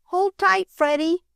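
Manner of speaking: high call to her brother. A narrator's reading. Sister calling to the dragged boy, high and urgent. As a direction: call out a warning across the yard. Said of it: "called Flossie".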